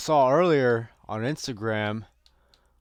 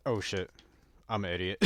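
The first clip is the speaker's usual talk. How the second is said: ordinary talk, nothing marked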